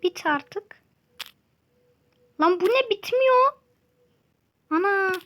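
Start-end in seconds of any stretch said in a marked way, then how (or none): 2.4-5.1 high, loud disbelief; exasperated shout. Real, not acted. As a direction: yell at the endless vein. Endless ore vein. (bitmeyen damara bağırarak isyan)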